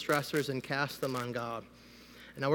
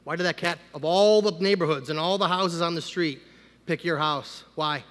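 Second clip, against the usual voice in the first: ordinary talk, nothing marked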